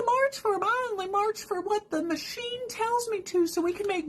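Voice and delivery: high, whiny parody voice; high-pitched mock whining; mocking dupe voice. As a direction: play a brainless follower way up high. Parodying people who follow TV.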